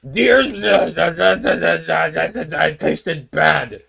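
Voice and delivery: very slow, drawn-out mock voice; slow mock killer. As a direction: drag each word out deadpan. Killer explaining the shooting.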